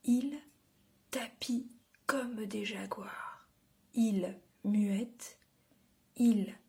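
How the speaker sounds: ringing repeated word, then measured lines with long pauses; declaimed poem, spaced out line by line; poem recitation. Acted. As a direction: recite it to children, projecting each opening word.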